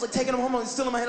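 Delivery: ordinary talk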